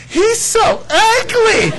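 very high, drawn-out mock squeal; exaggerated squealed outburst, stretched out; blurting teenage girl. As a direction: imitate an appalled teen girl, high and slow. Imitating teen blurting at new nephew.

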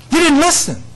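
high, loud rebuke; rebuking. Pretend. God rebuking Israel repeatedly.

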